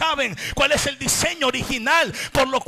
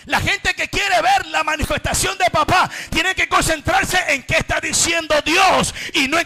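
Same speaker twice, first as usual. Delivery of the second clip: loud, driving shout to the congregation; preaching at full force; 7 semitones higher, 10 dB louder than usual. Acted. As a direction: pound each line like a fiery preacher. Predicación a gritos. (predicación a todo pulmón, gritando)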